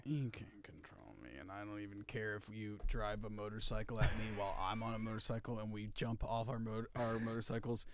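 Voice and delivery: low, hushed mock-villain monologue; movie villain. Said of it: "He's like".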